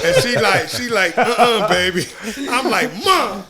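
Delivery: high, loud laughter through every word; laughing. Real, not acted. Laughing with friends about his hospital bed antics. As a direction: crack up while telling the punchline. Still laughing retelling mom.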